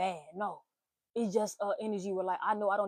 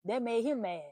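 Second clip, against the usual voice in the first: usual speaking voice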